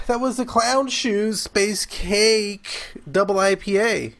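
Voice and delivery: high, upbeat show sign-off; announcer voice. Acted. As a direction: announce the outro like a host. Podcast outro sign-off.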